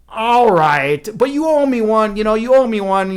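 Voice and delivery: loud, high put-on protest; feigned reluctance. Bride secretly glad to quit spinning, loud feigned reluctance. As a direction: give in loudly as if doing a big favour.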